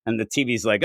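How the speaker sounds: ordinary talk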